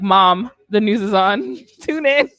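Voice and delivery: loud, slow, laughing call to mom; mock excited announcement. Quoting a meme to her co-host, loud and laughing. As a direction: shout it like breaking gossip, cracking up. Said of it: "I saw a meme, was like".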